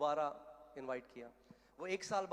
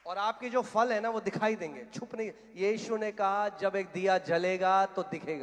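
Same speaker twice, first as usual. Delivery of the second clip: loud, higher-pitched preacher's proclamation; full-force preaching; 4 semitones higher, 10 dB louder than usual. Real, not acted. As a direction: preach it out to the whole hall.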